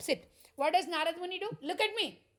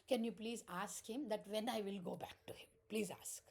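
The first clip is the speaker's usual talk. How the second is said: whispered request; 5 semitones lower, 7 dB quieter than usual. Retold or imitated. Brahmana's request voiced in story.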